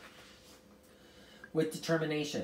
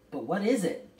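louder, higher insistent question; demanding; 5 semitones higher, 6 dB louder than usual. A narrator's reading. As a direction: demand an answer forcefully. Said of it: "Charles demanded".

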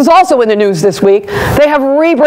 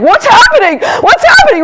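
shrill rising squeal, much higher and louder; giddy fangirl squeal, building higher; squealing panic; 10 semitones higher, 4 dB louder than usual. Retold or imitated. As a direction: squeal like a starstruck fan. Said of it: "I was like".

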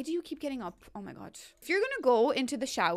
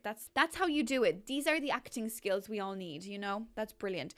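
ordinary delivery

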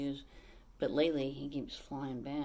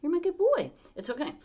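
high, louder praise for the bird; high loving pet voice; 13 semitones higher, 8 dB louder than usual. Real, not acted. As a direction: praise the parrot up high and loud. Praising parrot.

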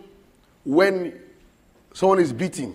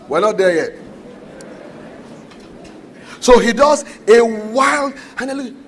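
usual speaking voice

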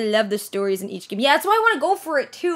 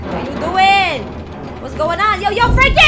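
loud calls climbing higher; calling out; 6 semitones higher, 6 dB louder, slower than usual. Pretend. Streamer hollers at game character, calling out. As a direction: build to a high, loud holler.

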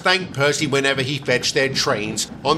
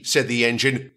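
ordinary delivery

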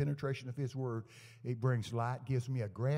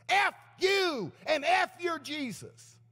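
high, loud, drawn-out yell; acted cursing outburst; 8 dB louder, slower than usual. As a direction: imitate a woman screaming abuse. Acting out the cursing woman's outburst.

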